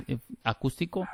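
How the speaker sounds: ordinary delivery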